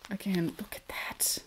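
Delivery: breathless whisper over the yarn; soft unvoiced whisper of admiration; whispered awe. Real, not acted. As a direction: hush it out in awe.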